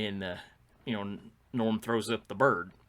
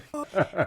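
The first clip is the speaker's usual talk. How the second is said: laugh; 11 semitones higher, 7 dB louder than usual. Real, not acted. Laughing after the yell.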